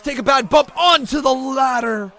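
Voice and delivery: high, shouted wrestling call; excited play-by-play. Real, not acted. Commentator watching Rollins hit the ladder, shouted play-by-play. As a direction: cry out the danger, stretching the slide. Calls the match live.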